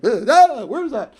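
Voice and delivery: sudden high, loud startled cry; startled exclaim. Retold or imitated. Panel members startled on stage, high loud cry. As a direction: panel members jumping at a voice out of nowhere.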